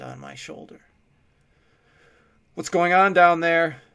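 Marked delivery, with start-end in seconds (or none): 2.5-3.8 loud alarmed shout down the stairs. A narrator's reading. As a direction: call down hard and urgent. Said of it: "Dante shouted".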